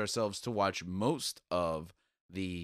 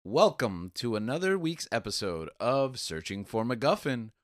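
loud, higher podcast intro; show announcer; 3 semitones higher, 8 dB louder, slower than usual. Acted. Host welcoming listeners to another week's episode, announcer style. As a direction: announce the show with a big build to the name.

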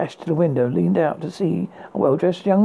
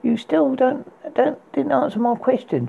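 high, pert comeback; retorting; 9 semitones higher than usual. Acted. Rapunzel retorts to the prince, high and cheeky. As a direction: snap back at him, cheeky. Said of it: "she retorted".

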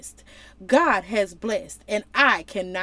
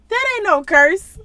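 emphatic exclaim; 9 semitones higher, 9 dB louder than usual. Real, not acted. Preacher exclaiming at the text.

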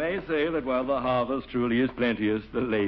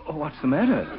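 usual speaking voice

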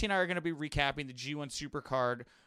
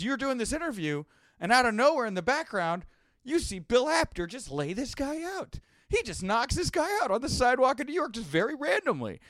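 excited retelling; 10 semitones higher, 5 dB louder than usual. Retold or imitated. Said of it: "He goes".

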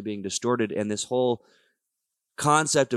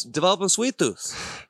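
put-on Jersey accent; 7 semitones higher than usual. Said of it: "We get a little joysy sometimes".

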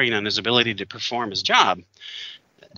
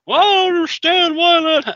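much higher, louder whining mock voice; mock whiny drunk; 7 dB louder than usual. Mocking a drunk player sent home, much higher whine. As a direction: parody the player's baffled complaint.

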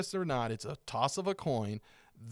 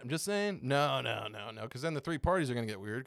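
usual speaking voice

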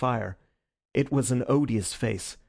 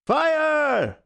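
loud, much higher shout; high, ringing call; yelled alarm; 6 dB louder than usual. A narrator's reading. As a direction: yell the alarm with full voice. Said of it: "we all yelled".

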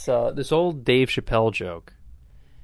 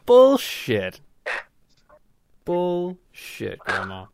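high, drawn-out comic scorn; scornful dismissal; 6 semitones higher, much slower than usual. Acted. Comic rejecting forced apologies to grandma, scornful dismissal. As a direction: scoff at it, stretching the word out.

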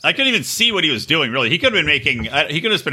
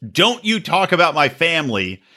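ordinary talk

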